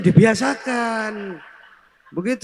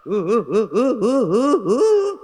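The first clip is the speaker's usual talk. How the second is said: mock hysterical sobbing; 2 semitones higher than usual. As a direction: ham up a widow's sobbing gasps. Widow sobs harder after consolation.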